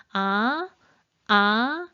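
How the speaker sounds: two 아, each sweeping upward; Mandarin second tone. Shown as a demonstration. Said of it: "이 성은 어떻게 발음해요?".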